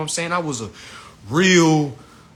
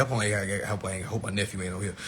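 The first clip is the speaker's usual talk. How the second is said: much softer, dropping the voice; hushed aside; 8 semitones lower, 10 dB quieter, faster than usual. Real, not acted. Comic checking for kids, dropping to a hush. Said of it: "do I got any kids on here".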